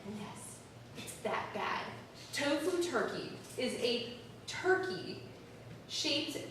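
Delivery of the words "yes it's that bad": unvoiced, soft confession; whispered aside. Real, not acted. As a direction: whisper it to the audience. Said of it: "aside after the reveal".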